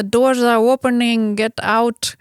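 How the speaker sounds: a hint of an Indian-accented station announcement. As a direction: call out like a tube worker with an Indian accent. Imitating Indian-accented tube announcer.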